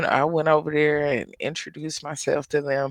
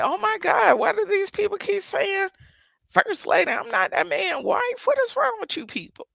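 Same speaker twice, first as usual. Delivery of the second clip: much higher-pitched, incredulous acting-out; exasperated protest. Reacting to church folk calling her first lady, exasperated. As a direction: act out fed-up disbelief in a high voice.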